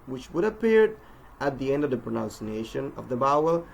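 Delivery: usual speaking voice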